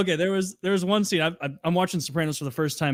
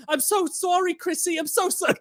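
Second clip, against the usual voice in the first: mock apology; 13 semitones higher than usual. Adriana apologizes to Chrissy, high meek plea. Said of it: "And she's like".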